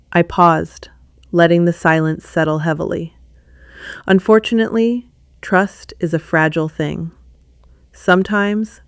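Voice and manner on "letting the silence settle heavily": plain reading voice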